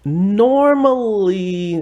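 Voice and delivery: high, slow stretched normally; drawn-out. Real, not acted. Drawn-out emphasis.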